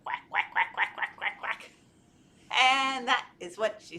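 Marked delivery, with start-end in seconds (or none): 0.0-1.7 breathy duck quacking; duck quacks. Shown as a demonstration. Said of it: "what does she say".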